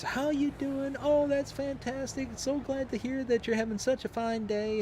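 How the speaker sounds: high, sweet Southern cashier voice; imitating a cheerful Southern cashier; cute southern drawl. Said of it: "the cutest southern drawl".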